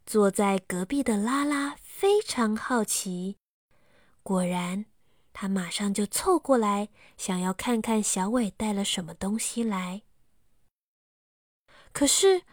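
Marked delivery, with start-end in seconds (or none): none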